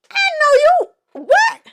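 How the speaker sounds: very high, loud, drawn-out squeal of disbelief; stretched-out, sky-high "oh no you didn't" shock; incredulous outburst. Acted. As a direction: squeal it in mock outrage. Host's outraged reaction to the retold line.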